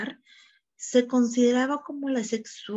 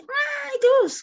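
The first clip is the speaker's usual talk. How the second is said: put-on child crying out; 6 dB louder than usual. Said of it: "O cuando el niño decía".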